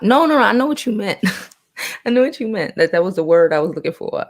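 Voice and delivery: eager, rushing reassurance; quick high excited correction; excited protest. Real, not acted. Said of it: "excited reassurance".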